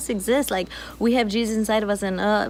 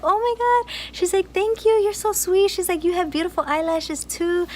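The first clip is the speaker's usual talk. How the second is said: much higher, gushing thrilled voice; delighted cashier; 10 semitones higher than usual. Retold or imitated. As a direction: play the cashier suddenly beaming.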